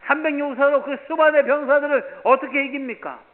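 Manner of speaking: loud, rising sermon climax; preaching at full force. Acted. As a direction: preach it out to the congregation. Rhetorical preaching peak. (힘차게 외치는 설교)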